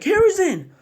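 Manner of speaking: very high shouted name; loud high call; anguished cry. Acted. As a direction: yell his name across the field. Minato calls out to Hiruzen.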